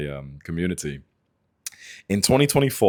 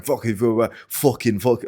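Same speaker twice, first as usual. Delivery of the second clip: mildly raised mock cursing; imitated angry swearing; 4 semitones higher than usual. Angry stranger swearing to himself in the gym, imitated angry cursing. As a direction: mimic his muttered stream of curses. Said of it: "hear him swearing".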